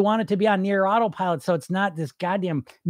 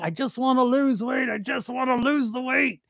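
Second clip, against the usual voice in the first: higher, mocking voice of a dieter; lightly mocking dieter impression; mock whiny dieter; 6 semitones higher, 3 dB quieter than usual. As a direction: parrot the viewer's thoughts back in a mock whine. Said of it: "I hear you saying".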